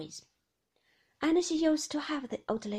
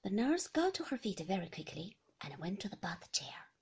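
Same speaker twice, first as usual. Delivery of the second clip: ordinary talk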